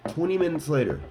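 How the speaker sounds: high announced time skip; dramatic time-card announcement. Acted. Marking the long wait for a jump, dramatic announcement. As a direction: call it out high like a caption card. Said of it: "Let me say it".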